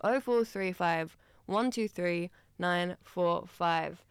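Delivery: slow, measured phone number. Real, not acted. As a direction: read the digits slowly in separate groups. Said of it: "I'll say that slower".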